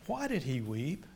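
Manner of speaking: usual speaking voice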